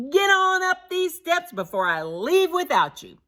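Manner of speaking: called back. A narrator's reading. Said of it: "called back to the snowbound drivers".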